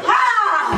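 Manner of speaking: high-pitched theatrical yell; sudden hammed-up distress cry. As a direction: cry out dramatically for the audience. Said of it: "c'est un cri de détresse".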